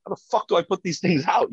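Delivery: exasperated retort. Retold or imitated. Retold exasperated reply to sergeant.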